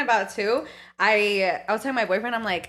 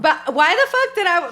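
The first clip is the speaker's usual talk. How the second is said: normal talking voice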